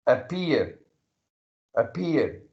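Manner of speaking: "appear" said separately, weight landing on P; stress on P. Shown as a demonstration. Teacher demonstrating the peak of pronunciation, stressed P in "appear". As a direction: punch the P in each "appear". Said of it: "putting stress on the letter P".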